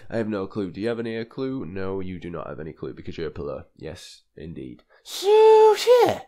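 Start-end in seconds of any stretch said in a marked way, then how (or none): 1.6-4.8 deep mock-pompous voice. Mock low pompous self-answer. 5.0-6.2 squeaky falsetto so. Pretend. Silly high squeal.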